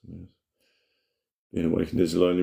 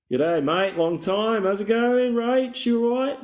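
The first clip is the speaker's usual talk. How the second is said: mock Aussie bloke; slower than usual. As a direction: greet your mate like a cartoon bloke. Greeting viewer in put-on voice.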